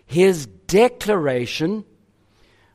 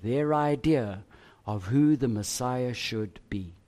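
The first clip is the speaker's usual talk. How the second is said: hushed teaching; 5 semitones lower, 6 dB quieter than usual. Acted. Preacher drops to soft teaching.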